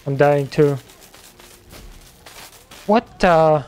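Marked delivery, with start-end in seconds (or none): 2.9-3.6 surprised. Real, not acted. Enemy appears suddenly in game.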